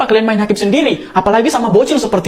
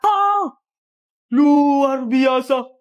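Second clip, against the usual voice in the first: mock awe; much slower than usual. Parent marvels at rival kid.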